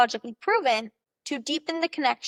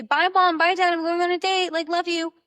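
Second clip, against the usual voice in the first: high, loud singsong goodbye to the parents; cheery goodbye call; 7 semitones higher, 5 dB louder than usual. Pretend. A girl calling goodbye to her parents as her date arrives, bright and loud. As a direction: sing out your goodbyes on the way out the door.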